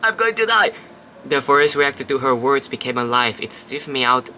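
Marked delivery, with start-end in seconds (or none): 0.0-0.7 high, loud hammed-up scream of fear; jokey imitation of being scared to death; mock panic. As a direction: play a melodramatic terrified cry. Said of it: "I was like".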